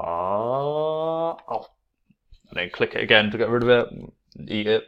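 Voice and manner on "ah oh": mock surprised gasp. Shown as a demonstration. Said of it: "And then I would go".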